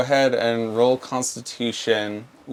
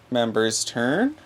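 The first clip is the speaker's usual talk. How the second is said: normal talking voice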